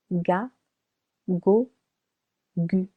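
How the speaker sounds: "ga, go, gu" each with a hard g; hard g sound. Shown as a demonstration. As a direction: hit the hard g in "ga, go, gu". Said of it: "It would sound".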